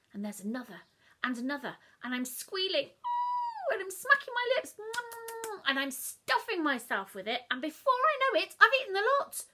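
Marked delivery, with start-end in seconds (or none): 2.0-9.4 much higher and louder, squealing; frenzied glee. A narrator's reading. Child gobbling the whole cake, squealing louder. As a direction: build to a greedy climax.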